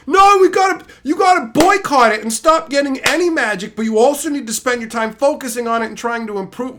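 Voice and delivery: high, loud mocking nag, speeding up; mock commenter. Streamer parodying boycott commenters, high and loud. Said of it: "Can you wrap your fucking head around that".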